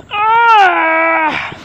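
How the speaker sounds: a sudden high, loud furious cry; raging yell. Real, not acted. Gamer yelling at the screen in a sudden loud furious cry. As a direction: let out a raw yell of frustration. Mid-game rage outburst.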